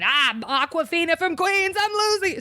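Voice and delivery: camp it up like a Queens talk show host; laughing Queens accent, hammed up. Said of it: "carrying on".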